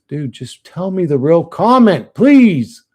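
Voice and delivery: fed-up streamer pleading with chat, voice rising to a near yell; exasperated plea. Real, not acted.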